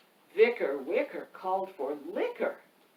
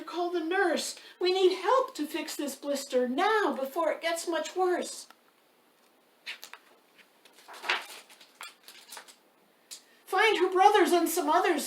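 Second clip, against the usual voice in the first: rising panic; 7 semitones higher, 5 dB louder than usual. A narrator's reading. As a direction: rhyme read higher and louder, building to frantic. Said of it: "She's getting a little hysterical".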